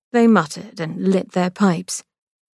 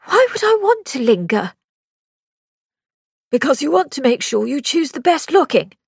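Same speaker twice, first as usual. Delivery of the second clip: higher, lightly voiced sly brother; Victor, teasing; 7 semitones higher, 3 dB louder than usual. A narrator's reading. Brother teasing sister about choosing, sly. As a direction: needle her with a touch of mockery.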